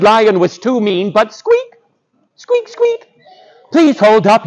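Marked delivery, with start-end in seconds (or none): 1.3-3.1 tiny high-pitched mouse squeaks. Acted.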